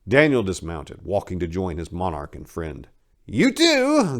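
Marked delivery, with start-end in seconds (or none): 3.2-4.2 high, gloating grin in the voice; grinning high priest. Acted. Belshazzar singling out Hab with a high, gloating grin. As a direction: grin as you single him out. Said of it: "grinned Belshazzar".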